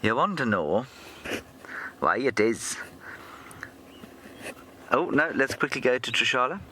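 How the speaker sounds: sing-song stab at Welsh; half-hearted Welsh lilt, soon dropped; attempted Welsh accent. Shown as a demonstration. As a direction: try on a lilting Welsh accent. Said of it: "Let me try".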